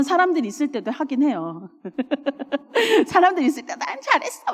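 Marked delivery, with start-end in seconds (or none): 3.1-4.5 higher, quick pat-on-the-back voice; higher quick self-praise. Retold or imitated. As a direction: cheer yourself on quickly.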